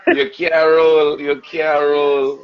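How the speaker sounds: put-on slow, high, loud jeer in Trinidadian accent; mocking Trini player. Elite steel band players hazing a newcomer, mocking Trini accent. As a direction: drag out each taunt with a Caribbean lilt. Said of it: "They laugh at you".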